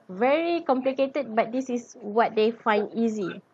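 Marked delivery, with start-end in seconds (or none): none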